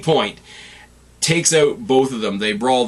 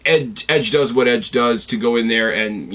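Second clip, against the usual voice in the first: ordinary talk, nothing marked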